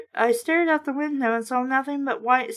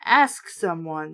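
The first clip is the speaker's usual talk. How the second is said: a hint of an exploding father; lower, slow exploding dad; 7 semitones lower than usual. A narrator's reading. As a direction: drag it out low, fed-up mock anger. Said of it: "Dad exploded".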